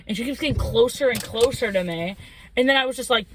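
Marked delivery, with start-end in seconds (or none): none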